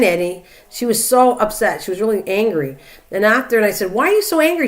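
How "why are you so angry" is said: higher, exasperated why-question to mom; retold exasperated question. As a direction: ask it like you can't believe she's mad. Retold argument with her mom.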